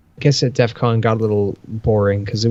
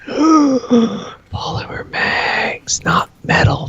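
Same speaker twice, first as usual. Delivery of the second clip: gasp, then whisper "Polymer mags" slow, very high and hushed; awed gasping whisper; 14 semitones higher, much slower than usual. Real, not acted. Showing the new polymer mags to viewers, awed gasping whisper. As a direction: breathe "Polymer" out in a long reverent whisper.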